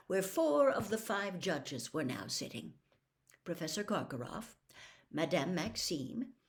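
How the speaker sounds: ordinary delivery, nothing marked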